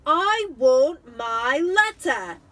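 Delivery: loud, slow insistent yell; shout. Acted. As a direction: shout to get his letter back. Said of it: "he shouted".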